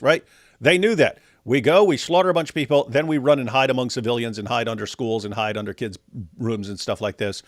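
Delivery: ordinary delivery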